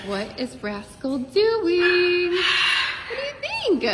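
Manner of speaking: high, slow squawky bird voice; voicing the parrot's thoughts. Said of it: "She's like".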